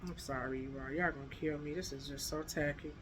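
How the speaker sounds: low, hushed apology; quiet, mortified muttering off to the side; embarrassed aside. Real, not acted. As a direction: murmur a sheepish apology under your breath. Embarrassed aside when recording is interrupted.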